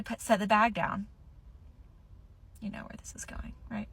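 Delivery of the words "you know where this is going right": unvoiced conspiratorial aside; whisper. Real, not acted. Vlogger whispers a teaser to her viewers. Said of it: "whispered aside".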